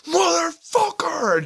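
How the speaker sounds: high, slow, explosive retold yell; furious yell. Retelling the gunner's outburst at the joke, furious yell. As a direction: let it burst out like he erupted. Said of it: "fucking erupted".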